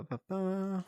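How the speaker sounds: normal talking voice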